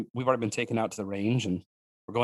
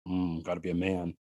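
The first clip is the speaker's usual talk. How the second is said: lightly voiced tough guy, lower; macho guy; 4 semitones lower, 4 dB quieter than usual. Guys full of testosterone to themselves, lightly mocked machismo. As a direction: drop it low for mock manliness. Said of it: "full of adrenaline and testosterone".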